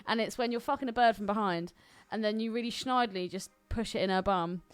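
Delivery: ordinary talk, nothing marked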